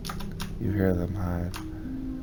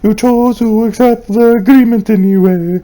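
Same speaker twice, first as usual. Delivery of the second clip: higher and louder, building to a proclamation; rising dramatic reading; 12 semitones higher, 9 dB louder than usual. Acted. Gamer reading the witch note out loud, building in force. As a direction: declaim it like a grim prophecy.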